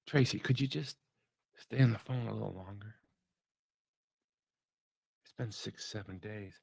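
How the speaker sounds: hushed, halting plea that fades away; soft plea. Retold or imitated. Pastor re-enacting asking his wife to stay on the phone, soft plea. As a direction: plead quietly, trailing down almost to nothing.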